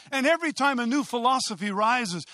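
ordinary talk, nothing marked

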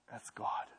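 whispered in wonder; whispered awe under stars; awed whisper. Retold or imitated. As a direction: breathe it out in awe.

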